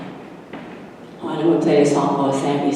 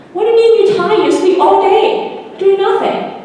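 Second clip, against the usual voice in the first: much higher, louder squeaky scolding voice; teasing scold; 5 dB louder than usual. Acted. Friend mocking Mikey for sleeping all day, squeaky teasing. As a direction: needle a lazy friend.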